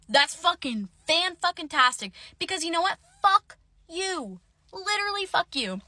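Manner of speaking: high-pitched, bitter exasperation; sarcastic anger. Retold or imitated. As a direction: build from sarcasm into an indignant kiss-off. Furious sarcastic reply to restraining-order text.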